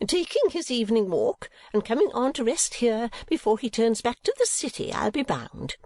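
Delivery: high, confident mother; knowing Mrs. Nickleby. A narrator's reading. Mother guessing at the old man's errand, assured. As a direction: guess it high, settling at the end.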